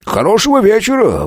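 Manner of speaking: «хорошего» high and stressed; «Хорошего вечера» sung up high, falling; fawning official. A narrator's reading. The official bows out. (угодливо «Хорошего вечера»)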